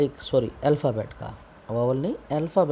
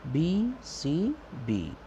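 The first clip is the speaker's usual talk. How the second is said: slow spelled pattern; 3 semitones higher, much slower than usual. Shown as a demonstration. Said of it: "rhyming scheme है".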